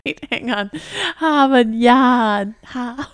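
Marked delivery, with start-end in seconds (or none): 1.2-2.5 put-on Boston accent. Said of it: "try to do your Boston accent".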